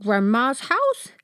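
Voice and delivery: slow, drawn-out slobbery dog; a dog slobbering with eager interest; slobbering enthusiasm. A narrator's reading. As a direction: drool out the words eagerly. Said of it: "slobbered Oscar enthusiastically".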